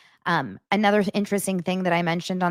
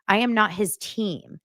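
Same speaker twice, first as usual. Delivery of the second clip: normal talking voice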